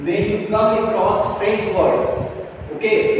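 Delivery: usual speaking voice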